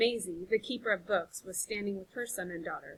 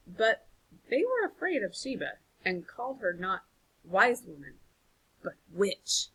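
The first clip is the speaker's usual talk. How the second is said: ordinary delivery, nothing marked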